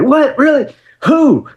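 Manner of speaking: high, amazed questions; teenage fan can't believe it; stunned disbelief. Retold or imitated. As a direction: gasp out each question in wonder. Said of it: "I'm like".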